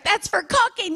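put-on high child's protest; little girl voice. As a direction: pipe up like a scolded little girl. Daughter protests during chase.

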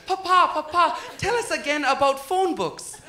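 put-on high, excited little kid pestering papa; eager great-grandchild. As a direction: play a child begging for a story. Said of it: "running up to their great-grandfather like".

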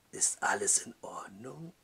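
soft, low sinister whisper; menacing whisper. Acted. The innkeeper whispers through the door, menacing. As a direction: whisper it with false concern.

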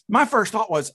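ordinary talk

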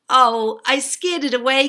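loud, high gleeful bragging; cackling boast. A narrator's reading. Arabella boasting to townsfolk, cackling. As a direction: crow it loudly. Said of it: "cackled Arabella".

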